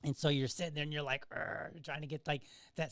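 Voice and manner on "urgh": a strained grunt of effort; strained push-up grunt. Retold or imitated. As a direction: grunt like your arms are giving out.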